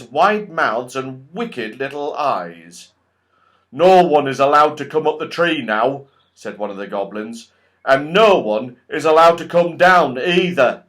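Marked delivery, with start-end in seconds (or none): 3.7-6.1 loud, gloating goblin guard. Acted. Goblin tells the children nobody may climb, loud and gloating. 7.8-10.8 loud, gloating goblin guard. Acted. Goblin tells the children nobody may climb, loud and gloating.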